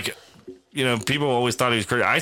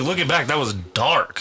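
usual speaking voice

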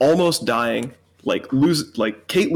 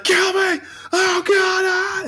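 panicked screaming, pitch way up; much slower than usual. Pretend. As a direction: scream it in agony. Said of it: "You'd be screaming".

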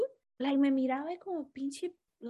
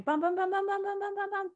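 high, rapid-fire "pam" repetitions; imitated bell banging; 9 semitones higher than usual. Impatient customer hammering the bell for attention, imitated bell banging. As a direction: imitate frantic hammering on a counter bell in one held high pitch. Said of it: "I started, like, going".

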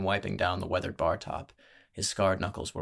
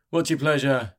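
drawling barkeep; 5 semitones higher, 6 dB louder than usual. A narrator's reading. Barkeep to a newcomer at the bar, drawling. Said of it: "he drawled".

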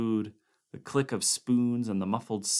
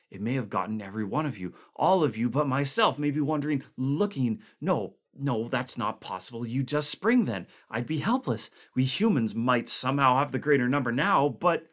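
higher and louder, fear building as he talks; rising paranoid dread; 4 semitones higher, 4 dB louder than usual. Acted. Van Wall voicing fear that the others are monsters, growing panicky. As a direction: spiral into suspicion of everyone.

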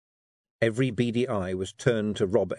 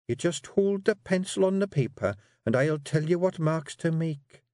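high Scots wifely voice; a firm wee wife steering her man; Jeanie coaxing; 8 semitones higher than usual. Acted. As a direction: Jeanie urging her husband to write.

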